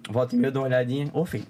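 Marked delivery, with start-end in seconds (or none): 1.1-1.4 softer imitated advice. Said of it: "ele vai orientando no WhatsApp".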